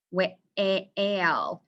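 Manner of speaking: "whale" split as "weh-ale", vowel stretched; long A. Shown as a demonstration. Teacher sorting vowel sounds, "whale" with long A. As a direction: sound out "whale" slowly with the A saying its name. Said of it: "or is it a long A".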